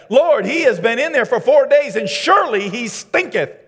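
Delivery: loud, high fiery preacher voice; booming pulpit delivery; full-force preaching. Acted.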